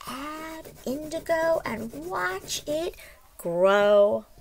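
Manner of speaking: lively storybook read-aloud; reading to children. A narrator's reading. Teacher reads the story aloud to the class, lively storybook voice. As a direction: read it like a picture book to little ones.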